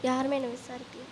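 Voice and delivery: very soft, low undertone; feed the line under your breath; hushed cue to a fellow actor; quiet prompt. Real, not acted. Teacher quietly prompts next line.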